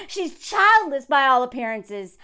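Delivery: ordinary talk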